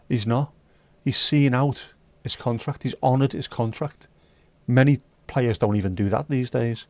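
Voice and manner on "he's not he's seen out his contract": usual speaking voice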